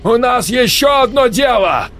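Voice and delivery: high loud urgent shout. A narrator's reading. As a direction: yell to wake him. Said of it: "выкрикивал Валерий Кротов". (крик)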